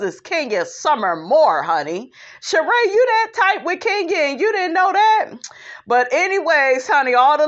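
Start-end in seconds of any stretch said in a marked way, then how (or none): 2.4-5.3 incredulous. Real, not acted. Calling out Sheree in disbelief.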